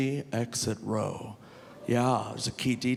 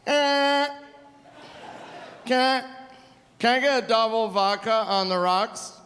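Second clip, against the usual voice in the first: put-on drunk guy ordering; 8 semitones higher than usual. Drunk man asks the bartender for vodka, high halting slur. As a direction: stumble through the order like a drunk. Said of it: "the guy goes".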